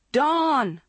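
calls the name out loud and high; calling out. A narrator's reading. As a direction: call across a crowded stairwell. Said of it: "she called again".

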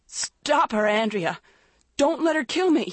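high-pitched terrified begging; desperate, panicked cry; pleading. A narrator's reading. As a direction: plead for your life. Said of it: "Maggie pleaded".

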